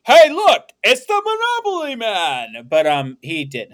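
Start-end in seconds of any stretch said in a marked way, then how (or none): 0.0-2.7 high, loud movie quote, easing down at the end; mock excited call-out. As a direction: call it out like a hammed-up discovery. Quoting Ace Ventura calling out.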